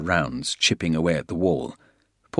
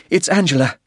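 high, loud, urgent breathless news; 10 semitones higher, 6 dB louder than usual. A narrator's reading. Tannen tells Porter who is calling over gunfire, breathlessly. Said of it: "she said breathlessly".

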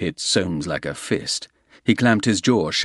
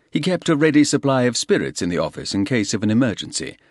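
ordinary talk

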